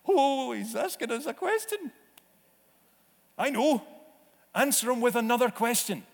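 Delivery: a disciple nervously working out what to answer; hammed-up nervous follower; eager disciples. Disciples' flustered whispered planning.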